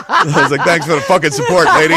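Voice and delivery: sarcastic thanks through laughter; laughing. Retold or imitated. Telling the seat neighbour off, laughing.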